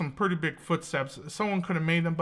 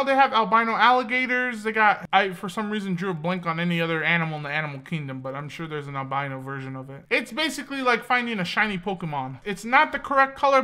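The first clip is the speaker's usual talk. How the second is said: normal talking voice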